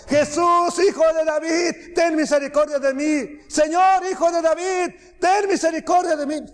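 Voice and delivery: high, insistent mock yelling; imitated shouting. Woman trailing Jesus begging mercy, high-pitched imitated shouting. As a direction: imitate someone calling out over and over. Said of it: "esta mujer gritando y gritando".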